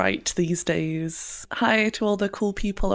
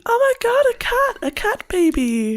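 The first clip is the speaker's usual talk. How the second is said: high, loud squeal at a cat; cat baby voice; 3 dB louder than usual. Retold or imitated. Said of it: "You sound like me when I see a cat".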